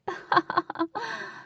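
high mocking laugh; taunting laugh. A narrator's reading. Rival laughing down at Katie, mocking.

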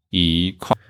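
一块 with 一 rising, 块 falling; 一 in second tone. Shown as a demonstration. Teacher adds another rule example, 一 rising before falling 块. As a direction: glide 一 upward, then drop 块. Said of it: "changed to second tone".

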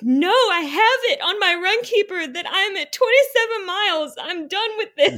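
high-pitched, upset protest through tears; tearful pleading. Retold or imitated. Runner begs the volunteer to let her finish, high and tearful. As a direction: plead with the volunteer, close to crying. I was crying.

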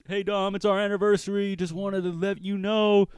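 high-pitched put-on caller voice; pretend caller. Lover calling the podcast for a shout out, pretend caller. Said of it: "maybe you wanna be like".

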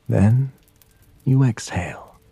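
usual speaking voice

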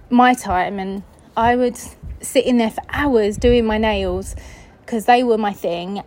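usual speaking voice

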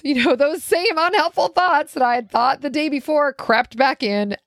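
high-pitched, amused telling; rueful laughing voice. Real, not acted. Woman admitting the bad thoughts crept back, laughing ruefully. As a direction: talk through a rising laugh.

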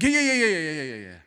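quick brush-off yeahs, starting high and loud; dismissive yeah yeah. Retold or imitated. Pastor imitating Martha brushing off Jesus, dismissive. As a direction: wave him off like you've heard it all. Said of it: "here's what Martha's saying".

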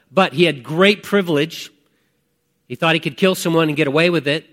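normal talking voice

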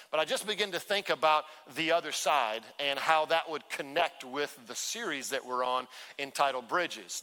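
usual speaking voice

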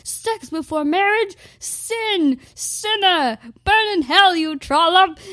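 high, loud preachy shouting; damn her to hell like a fire-and-brimstone crowd; condemning Christians. Retold or imitated. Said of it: "And they'd be like".

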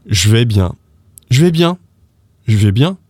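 "Je vais bien" as "zhvay-byan"; slang contraction. Shown as a demonstration. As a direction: model "Je" cut down to "zh" for repeating. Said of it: "repeat with me".

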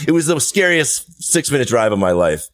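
usual speaking voice

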